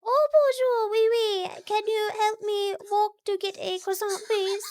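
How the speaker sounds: put-on high, quavering French-accented old lady; an old French woman pleading for a croissant, in a high French accent; elderly French woman. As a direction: play a frail old French woman asking for help, high. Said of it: "do a elderly French woman".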